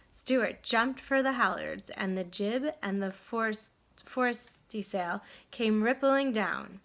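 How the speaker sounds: ordinary delivery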